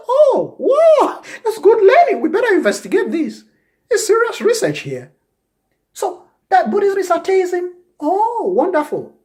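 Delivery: loud, very high gushing wonder; mock amazement. Acting out a reply to atheism claimers, hammed-up amazement.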